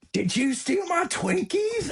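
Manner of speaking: very high, drawn-out mock shriek; imitated screaming. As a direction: imitate an outraged coworker's accusation. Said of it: "just started screaming at everybody".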